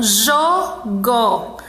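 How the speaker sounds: JOGO in two beats, jo, then go; split into syllables. Shown as a demonstration. Teacher showing pupils two syllables, jogo split apart. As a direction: say jogo syllable by syllable, pausing between. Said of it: "com um único movimento".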